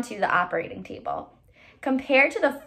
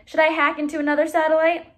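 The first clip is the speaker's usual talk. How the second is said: eager; 6 semitones higher, 4 dB louder, faster than usual. Acted. Eager boy Noah.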